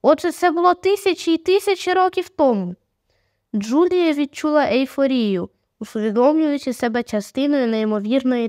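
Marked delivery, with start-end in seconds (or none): 0.0-2.7 higher-pitched, excited exclamation; excited cry. A narrator's reading. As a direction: exclaim it high, amazed at the discovery. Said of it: "скрикнула Джулія".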